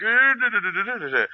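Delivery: high, loud nonsense griping; mock complaining. Fan mimics players griping about changes, high mock complaint. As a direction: imitate whiny complainers in babble. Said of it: "everyone would complain".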